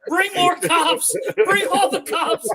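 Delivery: slow, high, laughing mock alarm; mock panic. Friend pretends to call the cops, slow hammed-up panic. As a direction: drag it out like a hammed-up emergency call.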